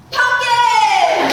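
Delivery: put-on high, very loud kid's shout; little boy shouting. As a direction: blurt it out at full volume. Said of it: "Go ahead, shout it out".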